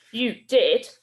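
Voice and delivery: loud angry retort; snarl. Acted. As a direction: throw it back at him. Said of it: "snarled Bill".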